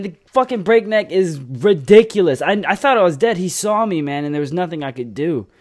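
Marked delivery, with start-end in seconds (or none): 0.0-4.0 higher and louder, worked up; excited. Real, not acted. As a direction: rattle it off still pumped. Relieved rant after close call.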